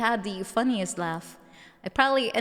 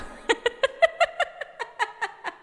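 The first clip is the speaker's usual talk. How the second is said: cackling laugh. Real, not acted. Laughing at own bit.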